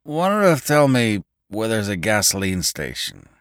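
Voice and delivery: lightly voiced determined remark; a touch of determination. A narrator's reading. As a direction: say it with dazed resolve. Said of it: "he remarked in a determined voice".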